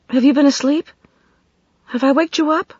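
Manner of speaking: high, questioning suspicion; suspicious. A narrator's reading. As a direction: ask it half suspecting. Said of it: "with suspicion".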